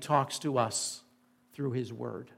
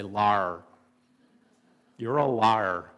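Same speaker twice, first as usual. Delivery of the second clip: low drawn-out Indiana "Lar", Indiana twang on "liar"; playful Indiana accent imitation; 4 semitones lower, 6 dB louder, slower than usual. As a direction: say "liar" like a Hoosier, squashed to "Lar". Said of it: "If I was still in Indiana, I would say".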